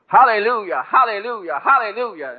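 high, loud, joyful hallelujahs; hallelujah, shouted high in joy; ecstatic praise. Retold or imitated. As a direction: let the praise burst out. She was having a good time.

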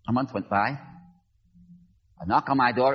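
ordinary talk

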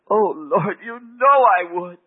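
loud, high, drawn-out cry to God; fervent cry. Retold or imitated. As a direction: cry it out with longing, high and slow. Woman answers the voice fervently.

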